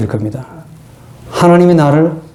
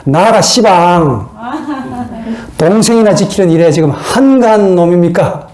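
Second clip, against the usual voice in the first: Jeolla dialect; 4 semitones higher than usual. Acted. Cain answering God, as a drawling Jeolla-dialect retort. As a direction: talk back in broad Jeolla dialect. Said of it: "전라도 버전으로 말하면".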